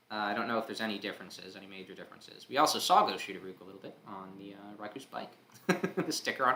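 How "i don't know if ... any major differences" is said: ordinary delivery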